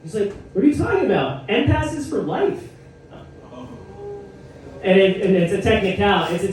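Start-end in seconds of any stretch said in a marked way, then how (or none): 0.5-2.6 much higher, indignant friend voice; Brian insisting. Retold or imitated. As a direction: protest like it's obvious. Brian defends his N pass.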